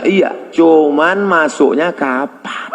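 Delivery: normal talking voice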